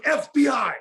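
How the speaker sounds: high, loud shouted claim; retold shouted ID. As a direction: bark it out to scare him. Bust, shouting identity.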